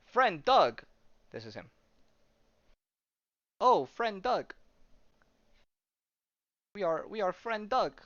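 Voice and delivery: high, halting alien voice with simple words; broken-English alien hero. Retold or imitated. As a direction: play a metal alien who barely knows English. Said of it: "he speaks like that".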